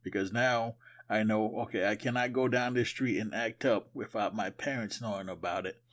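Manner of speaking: normal talking voice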